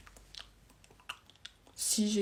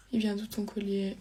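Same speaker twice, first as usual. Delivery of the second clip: normal talking voice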